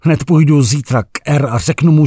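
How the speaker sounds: usual speaking voice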